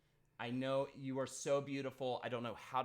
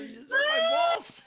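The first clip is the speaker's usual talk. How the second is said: very high, loud laughing yelp; real high pitched yelp; pained yelp; 12 dB louder, faster than usual. Real, not acted. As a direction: cry out in shocked pain. Yelps after being slapped.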